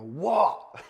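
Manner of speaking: mock startled phone pickup; startled answer. Cut man answering an unexpected call, startled.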